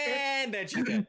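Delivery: high, hammed-up punchline; mock-cheery turn. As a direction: swing up into a drawn-out "and" for a comic grim twist. Abrupt turn from happy bunny to death.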